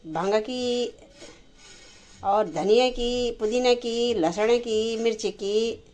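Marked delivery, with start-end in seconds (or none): none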